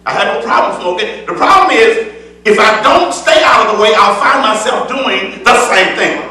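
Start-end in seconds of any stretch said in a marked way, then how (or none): 1.3-6.2 full-voiced preaching with rising fervor. Preacher exhorting the congregation in full preaching voice. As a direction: preach it out to the church. Preaching resumes after aside.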